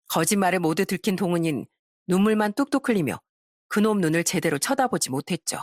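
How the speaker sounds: ordinary delivery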